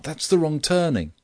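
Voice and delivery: high, frantic warning; wild shout. A narrator's reading. Friend has taken the wrong road, wild shout. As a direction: shout a correction after someone running off. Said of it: "I shouted wildly to Grant".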